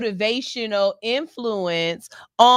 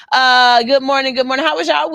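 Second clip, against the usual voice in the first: big cheerful hello, louder; loud greeting; 7 dB louder, faster than usual. Real, not acted. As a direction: call it out to the listeners.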